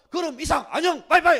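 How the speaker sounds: 높고 아주 크게 외치는 작별; 들을 틈 없이 통보하듯 크게; 일방적인 통보. Acted. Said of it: "일방적으로 말해요". (제 말만 하고 끊는 큰 소리)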